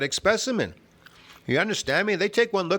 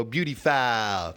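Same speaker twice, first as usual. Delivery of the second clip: drawn-out mock taunt; 4 semitones lower, slower than usual. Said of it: "and go".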